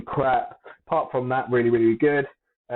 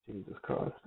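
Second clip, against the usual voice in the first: whispered exasperation; muttered; 3 semitones lower, 13 dB quieter than usual. Real, not acted. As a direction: breathe it out under your breath. Frustrated with file not loading.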